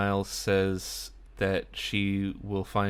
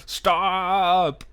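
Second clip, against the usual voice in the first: much higher, loud shouted stop; shouting; 9 semitones higher, 6 dB louder than usual. Retold or imitated. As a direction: yell it through the window. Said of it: "like, shouting".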